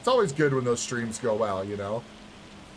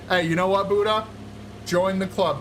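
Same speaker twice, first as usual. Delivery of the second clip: excited call-out; 6 semitones higher than usual. Real, not acted.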